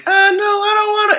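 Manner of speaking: very high, timid no; high girl refusing the beetle; reluctant girl. Acted. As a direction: shrink away from him, squeaky.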